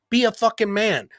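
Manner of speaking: ordinary delivery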